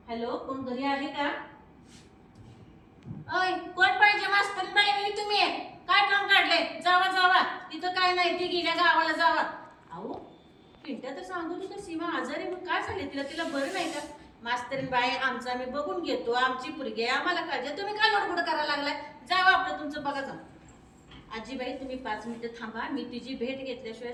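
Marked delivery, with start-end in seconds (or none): 3.4-9.4 put-on high, loud old woman's voice; rude grandmother shouting. Grandma drives the schoolteacher off, loud and rude. Grandma shooing the teacher away. 14.4-20.3 put-on louder, dismissive old woman; scornful grandmother. Grandma tells teacher to leave, loud and scornful.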